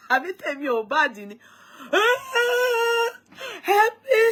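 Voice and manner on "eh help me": much higher, wailing help me; mock crying plea. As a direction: wail like a sick man begging for help. Imitating crying men in hospital.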